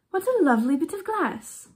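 put-on high-pitched girlish exclamation; delighted cry. A narrator's reading. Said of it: "cried the little girl as she ran home, laughing".

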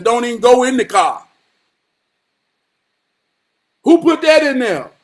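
loud, driving preacher cadence; shouted call-and-response sermon rhythm; preaching at full force. Real, not acted. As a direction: hammer each line like a sermon climax. Sermon-style build at full force.

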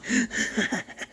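high mad giggle; eerie laugh. Acted. Psycho giggling after the whisper. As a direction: giggle to yourself, unhinged.

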